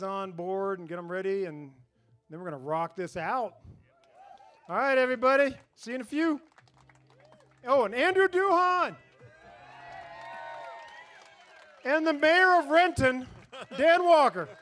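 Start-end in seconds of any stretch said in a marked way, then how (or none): 4.7-6.4 loud, raised-pitch sign-off to the crowd; calling out. Real, not acted. Host sends the crowd off to break, calling out. 7.6-14.5 big, high, loud shout-out to the performers; announcer's shout. Real, not acted. Host hails [name] and [name] to the crowd, shouting.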